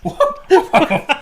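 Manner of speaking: high yapping barks; dog barks. Acted. Host barking as the rescue dog, high and yappy. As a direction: bark like an excited dog.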